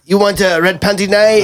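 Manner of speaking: high-pitched mock Irish fighter; Conor McGregor. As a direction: taunt in a put-on, high Irish accent. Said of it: "it sounded more like Conor McGregor".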